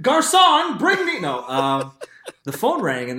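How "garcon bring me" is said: high, loud, pompous call to a waiter; hammed-up rich guy calling a waiter; mock posh order. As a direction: summon the garçon like a spoiled rich kid.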